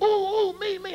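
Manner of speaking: high, eager imitation of a young lady; excited volunteer. Young lady begging the karate man to pick her, mock excited. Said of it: "she was really excited".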